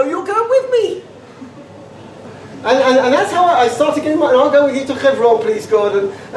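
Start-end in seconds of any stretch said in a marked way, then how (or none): 0.0-1.0 high, slow mock wife with a laugh; high mock wife insisting. Wife voiced.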